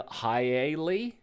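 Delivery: "Hi-Ailey" with an extra syllable wedged in. Retold or imitated. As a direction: stretch "Hi-Ailey" into three syllables, Hi-AY-lee. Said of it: "add an extra syllable".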